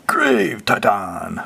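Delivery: slow, drawn-out dramatic reveal; card name announcement. Acted. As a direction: announce it grandly, stretching each word.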